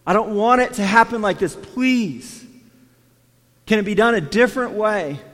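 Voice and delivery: pleading prayer. Acted. Jesus begging the Father in Gethsemane, pleading. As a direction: plead for the cup to pass. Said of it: "he begins to beg the Father".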